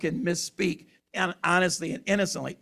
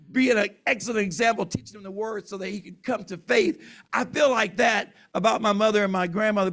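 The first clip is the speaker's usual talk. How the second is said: ordinary delivery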